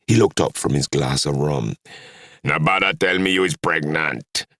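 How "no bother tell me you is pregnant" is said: father's thick Trinidad dialect voice; heavy Trinidad accent. A narrator's reading. Rum-drinking dad answers his daughter, heavy Trinidad accent. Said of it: "in his heavy Trinidad accent".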